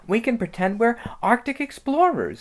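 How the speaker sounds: put-on eager little girl's voice; excited Lucy. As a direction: pitch it up, thrilled by the game. Lucy proposing a pretend game.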